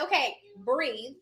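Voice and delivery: slow right down to settle someone panicking; retold calm coaching. Retelling calming her panicking mother.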